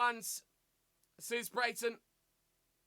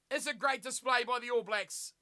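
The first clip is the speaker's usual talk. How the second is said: usual speaking voice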